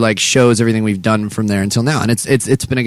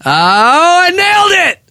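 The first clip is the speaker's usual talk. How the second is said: much higher, louder slow crowing shout; triumphant; 5 dB louder, much slower than usual. Real, not acted. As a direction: crow it out in triumph. Triumph after a crude pun.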